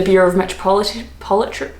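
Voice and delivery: stumbling on "Metropolitan, Politri-", breaking off mid-word; tripping over word. Real, not acted. Vlogger naming the weather bureau, tongue-tied on "Politri-". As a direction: garble the end of "Metropolitan", retry as "Politri-" and give up. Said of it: "why can't I say that word".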